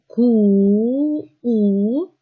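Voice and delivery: ку-у with a long, held "u" vowel; sounding out. Shown as a demonstration. Teacher isolating sounds for pupils, vowel held long. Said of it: "який ми чуємо".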